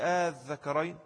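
long held آه running into الذكرين; مد الاستفهام. Shown as a demonstration. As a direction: stretch the first vowel before الذكرين. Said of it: "بقت همزة استفهام". (مدّ «آه» في أول «الذكرين»)

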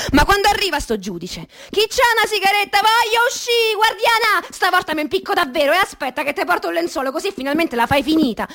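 high, fast calls of a worn-out prisoner; pleading prisoner, pitched high and hurried; desperate inmate cries. Retold or imitated. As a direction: cry out to the guard like a desperate inmate.

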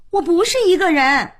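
high-pitched daughterly protest. A narrator's reading. Daughter objecting to going out alone being assumed, high protest.

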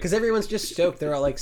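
ordinary talk

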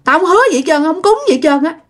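high, insistent denial; acted defiant retort; arguing back. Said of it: "ổng mới cự lại".

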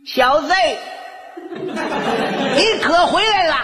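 high-pitched mock-menacing mother; confronting mother. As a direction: pounce on the son you waited up for. Waiting mother confronts her son. (尖声质问儿子的老妈)

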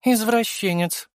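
high, drawn-out female character voice, huffy; slow, high-pitched scoff; indignant snort. A narrator's reading. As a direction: huff it out slowly and high. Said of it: "фыркнула Венера".

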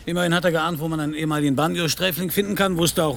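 heated retort. Acted. Detective arguing with the thug, heated and insistent. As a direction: push back, rising and urgent.

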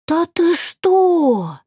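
high slow astonished gasp; gasping pixies. A narrator's reading. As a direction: gasp it out like stunned fairies. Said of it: "ахнули феечки".

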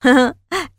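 short, high, loud jeering laugh; Fred laughs at Danny, mocking and loud. A narrator's reading. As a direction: laugh in his face. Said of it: "ضحك فريد".

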